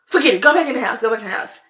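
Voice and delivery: imitating an exasperated mother's order; retold sharp order. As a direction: order the child inside, quick and loud. Retold mother ordering child inside.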